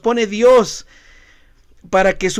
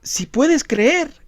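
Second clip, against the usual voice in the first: very slow, rising on creer; slow emphatic; 5 semitones higher, 3 dB quieter, much slower than usual. Retold or imitated. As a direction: press the challenge slowly. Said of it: "cuando Jesús le dice". (lento, subiendo en creer)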